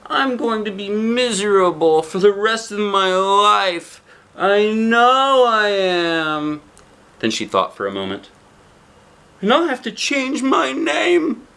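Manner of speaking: put-on a small girl about to cry; tearful. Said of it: "She sobbed".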